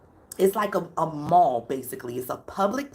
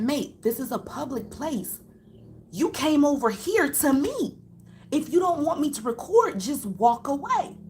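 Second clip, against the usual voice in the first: higher-pitched imitated standing of ground; retold firm protest; 7 semitones higher than usual. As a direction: act out the man holding his ground. Retold argument with filmed group.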